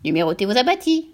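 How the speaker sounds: husky, veiled parrot; muffled, raucous bird voice; veiled, hoarse voice. A narrator's reading. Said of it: "voilée et rauque cette fois-ci".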